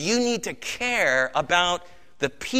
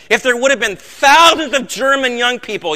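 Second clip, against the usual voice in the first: put-on louder and higher, the words thousands of German young people driven hard; louder, higher preaching; 5 semitones higher, 8 dB louder than usual. Shown as a demonstration. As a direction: preach the line with rising force.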